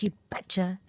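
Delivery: lightly put-on Midwestern 'You betcha'; mock Midwestern you betcha. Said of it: "Maybe Becca says".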